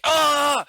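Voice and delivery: sharp cry of pain as if shot; pained yelp. Retold or imitated. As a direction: yelp like you just got hit. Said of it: "you're like".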